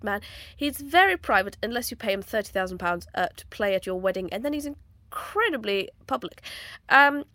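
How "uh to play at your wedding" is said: ordinary delivery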